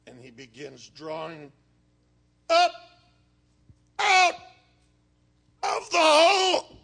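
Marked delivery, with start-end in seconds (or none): 2.5-6.7 high, loud strained cry on each word; straining to heave. Acted. Preacher acting out hauling from the hole, straining. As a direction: haul it up word by word, shouting.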